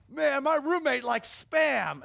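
high, slow jeering announcement; mocking roommate. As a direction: show off the roommate mocking him. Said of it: "telling me".

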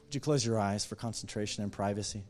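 preacher leading a salvation call; altar call. Acted. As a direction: invite them gently, unhurried.

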